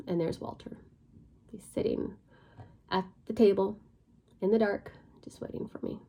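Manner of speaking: hushed suspense. Retold or imitated. Telling how Walter waited in the dark, hushed whisper. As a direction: let it sink in, hushed and eerie. Eerie reveal of Walter waiting.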